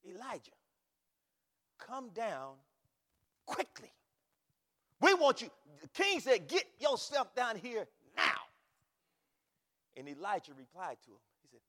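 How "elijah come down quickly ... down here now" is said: emphatic command building to loud and high; more emphatic. Retold or imitated. Second captain relaying the king's order to Elijah, emphatic. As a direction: order him down, pressing harder to the end. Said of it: "they're more emphatic".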